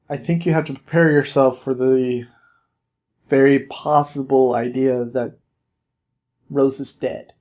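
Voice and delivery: low, halting, sombre delivery; grave bad news. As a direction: prepare the listener gently, pausing before the worst. Bigsby tells Snow sister may be dead.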